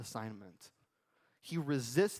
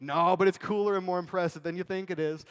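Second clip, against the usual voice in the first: mock boastful; 6 semitones higher, 10 dB louder than usual. Mock boast of pastor's calling.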